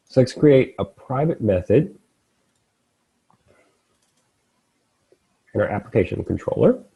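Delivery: talking while chewing; words around crunchy food; crunching chips. Real, not acted. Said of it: "apologies if you can hear me crunching that".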